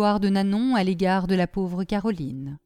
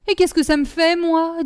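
high, loud defiant retort; heated, raised-voice defiance; angry outburst; 8 semitones higher, 6 dB louder than usual. A narrator's reading. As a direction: snap back that you couldn't care less. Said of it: "leur fit honte de leur emportement".

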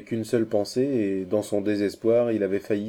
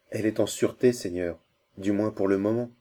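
usual speaking voice

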